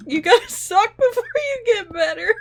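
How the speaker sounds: giggly self-mockery; real laughter breaking the line; laughing. Real, not acted. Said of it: "I'm crying again".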